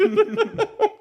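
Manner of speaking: high-pitched laugh. Real, not acted.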